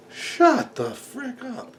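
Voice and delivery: high, slow scold at the dog; snapping at the dog. Real, not acted. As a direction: cut off to hush the barking dog. Yelling at barking dog mid-video.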